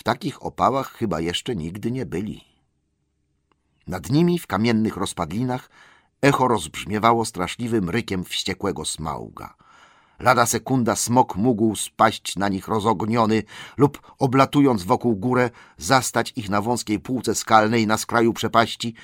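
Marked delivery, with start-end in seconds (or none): none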